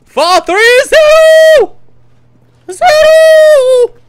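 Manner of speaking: high, loud, drawn-out yell; slow, soaring shout; hyped shouting. Real, not acted. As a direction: stretch it out like a battle cry. Calling for the door, hyped.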